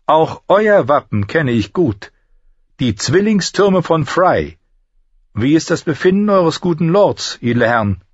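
high, measured, formal lady; courteous noble lady. Acted. Lady greets the soldiers of Frey, high and courteous. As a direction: address the men with stately courtesy.

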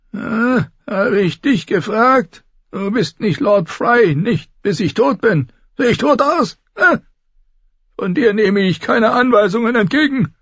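put-on high, scornful old man scolding his son; cranky old lord. A narrator's reading. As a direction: bite back up high like a contemptuous old tyrant.

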